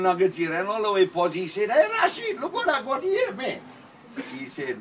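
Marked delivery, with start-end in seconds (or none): 1.7-3.6 high, laughing call of "Hey, Rashid"; bursting with glee over the find; excited call. Acted. Man shows off his gold to Rashid, laughing and high.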